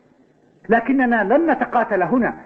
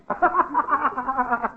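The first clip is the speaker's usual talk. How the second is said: high scornful laugh, softer; mocking laugh; 6 semitones higher, 4 dB quieter than usual. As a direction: laugh in his face. Rival laughs after calling coward.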